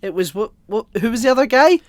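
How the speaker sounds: loud, high mock rant as Tony; Tony losing it. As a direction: blow up in an outraged yell, voicing the boss. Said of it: "Tony loses his shit".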